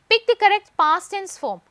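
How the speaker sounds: high, loud and very slow, word by word; dictating an exam question slowly; slow question read-out. Shown as a demonstration. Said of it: "reading the exam question aloud".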